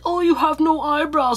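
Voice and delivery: high taunting mock voice; mocking teaser. Mocking those who teased thin eyebrows, high taunting voice. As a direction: tease like a snide classmate.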